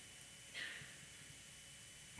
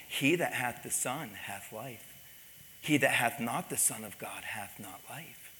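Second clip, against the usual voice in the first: soft, level scripture quote; calm; 2 semitones lower, 5 dB quieter than usual. Retold or imitated. Pastor repeating the verse to a shouting man, calm. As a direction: stay unruffled and recite it quietly. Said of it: "I just said calmly".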